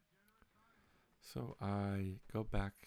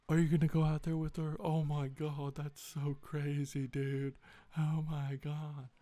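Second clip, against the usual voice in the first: higher, louder mock bro hype; mocking friends; 5 semitones higher, 5 dB louder than usual. Friends mock him for going outside, higher bro voice. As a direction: drag it out like a bro egging him on. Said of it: "And my friends".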